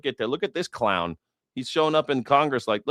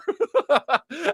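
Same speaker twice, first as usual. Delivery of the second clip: high mocking laugh. Real, not acted. Mocking laugh between taunts.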